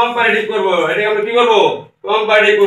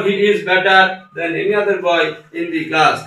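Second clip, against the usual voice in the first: slow dictation; 2 semitones lower, slower than usual. Shown as a demonstration. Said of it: "তাহলে কি হলো".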